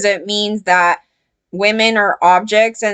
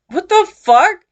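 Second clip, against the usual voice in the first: shocked outburst; 10 semitones higher, 3 dB louder than usual. Real, not acted. Outraged reaction to the comic's words.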